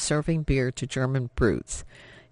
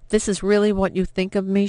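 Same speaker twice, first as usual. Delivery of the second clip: hurt disbelief; 6 semitones higher, 4 dB louder than usual. Acted. Hurt daughter retorts.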